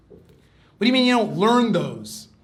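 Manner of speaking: loud, high, drawn-out incredulous protest; exasperated disbelief. Retold or imitated. Student challenging a man over forbidden books, loud exasperation. Retold question repeated, escalating in outrage.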